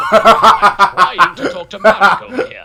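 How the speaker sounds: loud laughing yell to keep it down; annoyed shout. Acted. Joey yelling at Rio to let him talk.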